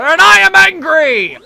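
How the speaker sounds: a hammed-up villain's roar; angry yell. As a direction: roar it out in full rage. Kammy's slip, angry Bowser voice.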